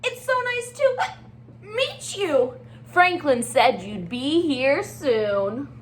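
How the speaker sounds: bright, chatty penguin voice with hiccup fuss; excitable Little Penguin. Acted. Teacher reading aloud as Little Penguin to her class. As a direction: play the fretful little penguin.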